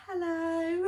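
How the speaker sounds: high, timid put-on hello; shy posh hello. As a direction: breathe it out shyly. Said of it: "really shy".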